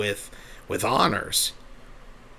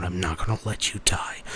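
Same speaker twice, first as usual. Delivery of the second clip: unvoiced whisper, rising at the end; a whispered inner promise to save them; whispered vow; 3 semitones lower, 5 dB quieter than usual. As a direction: breathe the promise as a desperate whisper.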